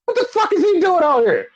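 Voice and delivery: high-pitched mock bafflement; squeaky-high retold disbelief; Seth Rollins. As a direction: imitate an utterly thrown reaction, voice way up. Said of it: "the first thing Seth is like".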